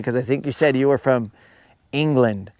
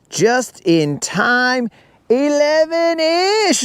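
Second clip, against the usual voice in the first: excited drawl; 11 semitones higher, much slower than usual. Real, not acted. Celebrating catch timing.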